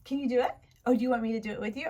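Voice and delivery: ordinary talk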